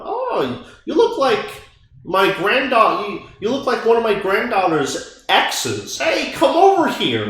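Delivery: put-on high old lady voice, a bit quavery; grandma voice. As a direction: play a chatty grandma calling someone over. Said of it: "the grandma".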